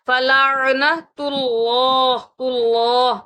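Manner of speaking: फलानतुल्लाह very slow, full heavy Allah. Shown as a demonstration. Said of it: "उस अंदाज में पढ़ने की कोशिश करें".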